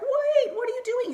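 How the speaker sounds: very high, exaggerated imitation of doubters; mock confused alarm. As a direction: stretch it up high in mock disbelief. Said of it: "people who are just kinda confused".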